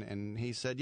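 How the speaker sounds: normal talking voice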